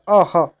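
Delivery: अह with a sounded h at the end; visarga like ह. Shown as a demonstration. Teacher demonstrating visarga, अह ending in an h sound. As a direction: release अह into a clear ह. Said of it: "ह के समान होता है".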